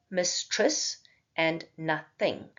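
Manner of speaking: "mistress" and "nothing" drawn out slowly, split into two syllables each; slowly over-pronounce both parts of "mistress" and "nothing"; exaggerated syllables. Shown as a demonstration. Teacher showing students how syllables divide, exaggerated pronunciation. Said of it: "I just exaggerated it there".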